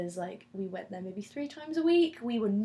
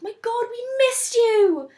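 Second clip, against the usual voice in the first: much higher, louder, slow gushing cry; excited welcome; 14 dB louder, slower than usual. Retold or imitated. Friends greeting her back home, overjoyed and loud. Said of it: "you were like".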